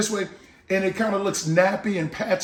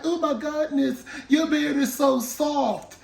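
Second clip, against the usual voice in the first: impressed woman; 6 semitones higher than usual. Retold or imitated. Host voicing a woman touching his beard, mock swoon.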